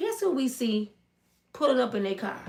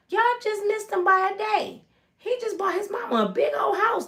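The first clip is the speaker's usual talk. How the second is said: lightly voiced neighbor; 9 semitones higher than usual.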